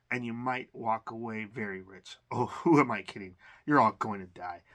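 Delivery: usual speaking voice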